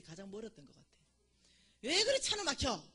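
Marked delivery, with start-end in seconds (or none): 1.8-2.8 irritated outburst. Retold or imitated. Angry in traffic, retold.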